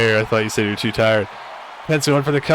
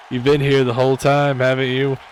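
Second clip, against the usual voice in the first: usual speaking voice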